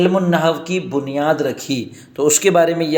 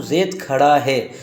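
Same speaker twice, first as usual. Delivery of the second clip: slow translation; 4 semitones lower, slower than usual. Shown as a demonstration. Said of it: "زیدن قائمن".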